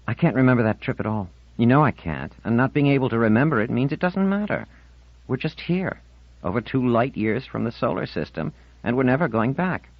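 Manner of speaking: put-on a touchy fifteen-year-old digging in; young girl's voice, defensive; defiant teen girl. Marlena complaining.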